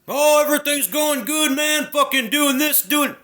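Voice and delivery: high, loud mocking voice; mocking fake cheer. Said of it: "They came in with a mask".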